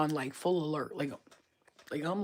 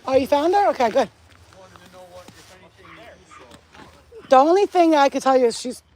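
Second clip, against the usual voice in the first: loud, raised voice across the yard; calling out to police; 5 semitones higher, 12 dB louder than usual. Real, not acted. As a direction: call out to officers at a distance.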